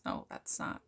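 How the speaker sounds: breathy aside under the breath, almost silent; whispered self-correction. Real, not acted. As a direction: drop to a whisper to correct yourself.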